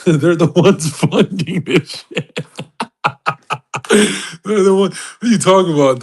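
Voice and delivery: cracking up, words pitched high through the laughter; laughing while talking. Real, not acted. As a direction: barely get it out for laughing. Cracking up at own joke.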